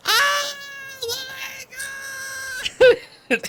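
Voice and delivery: shrieking, very high joyful cry; ecstatic scream. Retold or imitated. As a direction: scream it with overwhelming joy. Daughter sees the car outside.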